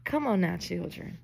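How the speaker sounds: soft, weary exasperation, fading out; quiet, amused disbelief; exasperated aside. Retold or imitated. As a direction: sigh it off like a tired auntie. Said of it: "I was like".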